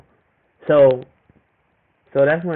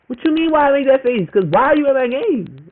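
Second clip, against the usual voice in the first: pitched up, outraged; incredulous, high and sharp; indignant disbelief; 8 semitones higher than usual. Retold or imitated. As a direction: ask it like she's crazy to even wonder. Retold reply to ex's text.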